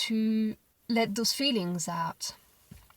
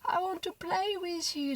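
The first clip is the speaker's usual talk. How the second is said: imitated crying child; 9 semitones higher than usual. Mother imitating her daughter's wail, a hint of crying. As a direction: stretch it out like a sobbing toddler. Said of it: "this is what came out of her mouth".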